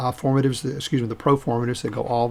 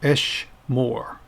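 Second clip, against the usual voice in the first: "Esh Moore" slowly, in two separate beats; pronounced for repetition. Shown as a demonstration. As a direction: model "Esh Moore" for learners to copy, slowly. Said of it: "pronounce them after me".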